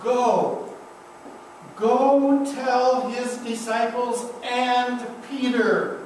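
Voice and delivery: slow, deliberate charge; a hint of a solemn commission. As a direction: send her out with weight on each word. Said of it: "she's given a commission".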